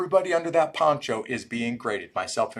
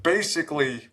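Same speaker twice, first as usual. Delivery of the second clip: ordinary talk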